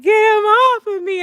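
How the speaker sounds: high, loud frantic plea; hammed-up scream for help; panicked yell. Yelling to classmate with spider on her, panicked.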